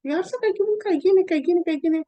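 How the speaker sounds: high, loud mock lecturing; bossy friends. Friends lecturing her on what to do, high and loud. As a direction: rattle off orders like a know-it-all.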